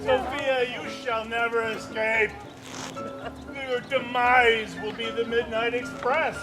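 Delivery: ordinary talk, nothing marked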